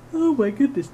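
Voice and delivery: words stretched by a yawn. Real, not acted. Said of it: "now I'm yawning".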